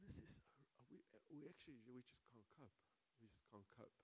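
softly giving up; hushed despair. Retold or imitated. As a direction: let the voice sink as if at the end of their rope. Couple overwhelmed by disaster.

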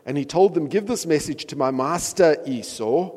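animated scripture reading. Acted. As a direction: read the passage like a story to the flock. Reads scripture aloud.

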